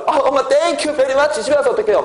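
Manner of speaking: put-on high-pitched, naively grateful kid; 고마워하는 학생. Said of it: "그래가지고 여러분들". (높은 톤으로 엄마에게 고마워하는 학생 흉내)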